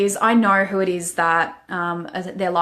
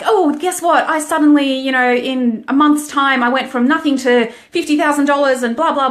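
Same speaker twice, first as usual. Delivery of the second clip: mocking braggart; 6 semitones higher, 5 dB louder than usual. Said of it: "are like".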